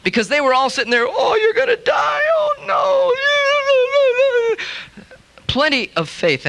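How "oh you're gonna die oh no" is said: much higher, hammed-up crying wail; mock wailing. Relatives round the bed wailing, hammed-up mock crying.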